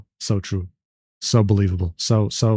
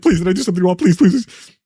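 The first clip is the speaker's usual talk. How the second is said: rising, louder pleading whine; whiny begging; 3 semitones higher, 3 dB louder, faster than usual. Retold or imitated. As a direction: mimic the clingy boyfriend begging. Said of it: "he's just like".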